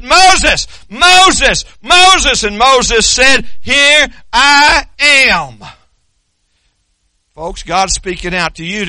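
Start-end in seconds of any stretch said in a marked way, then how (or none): 0.0-2.4 loud, high call of a name, repeated; God calling out. Acted. God calling Moses from the bush, calling out. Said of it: "God spoke out to him". 3.6-5.6 answering call. Acted. Moses answers God's loud call.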